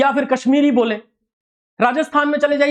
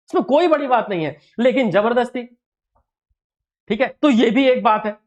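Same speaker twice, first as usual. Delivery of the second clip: ordinary delivery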